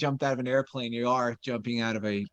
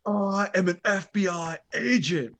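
higher-pitched, over-passionate movie-hero imitation; Keanu; 5 semitones higher than usual. Imitating Keanu confessing in the back of the car, passionate delivery. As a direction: play the earnest agent, pitch pushed up. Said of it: "it's just the delivery".

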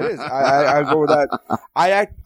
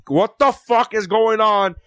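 exasperated outburst, higher and drawn out; retold anger; 6 semitones higher, slower than usual. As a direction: re-enact your angry disbelief, slow and high. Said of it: "I was getting angry going".

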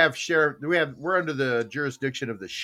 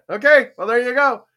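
normal talking voice

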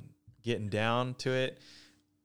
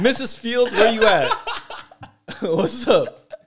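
a higher, louder laughing shout-out; playful call-out; 11 semitones higher, 10 dB louder than usual. Acted. Calling Missus Fields to sponsor the show, playful call-out. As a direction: hail a sponsor across the room.

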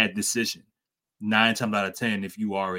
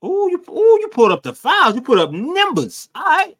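high hyped-up squeal at his friend; loud, high teasing hype; excited; 9 dB louder than usual. Real, not acted. Teasing his friend for pulling up the stats, hyped and high.

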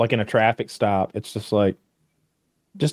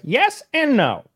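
high, slow smug lawyer voice; mock lawyer, high and slow; imitating the lawyer; 13 semitones higher, slower than usual. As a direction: drag it out like a smug know-it-all. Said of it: "he goes".

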